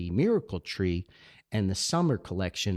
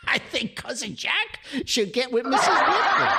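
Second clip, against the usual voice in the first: a giggly matchmaking joke, higher and bubbling; laughing aside; 13 semitones higher, 4 dB louder than usual. Real, not acted. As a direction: tease it through laughter.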